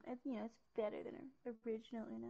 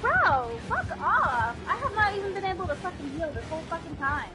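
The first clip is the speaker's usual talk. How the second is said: angry; 6 semitones higher, 7 dB louder than usual. Real, not acted. Frustrated gamer yelling at game.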